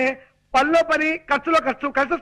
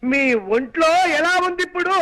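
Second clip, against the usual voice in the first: raised voice. Acted. Son-in-law repeats question to deaf mother-in-law, raised voice. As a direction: project it for someone hard of hearing. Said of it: "అంత గట్టిగా అరవకు నాయనా".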